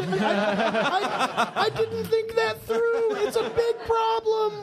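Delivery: very high, drawn-out comic wail; mock whining. Writer moaning to his colleagues about his own plot, mock whining. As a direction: whine it out in a squeaky, hammed-up lament.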